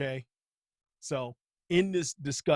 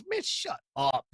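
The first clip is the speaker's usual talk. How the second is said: mock retort; slower than usual.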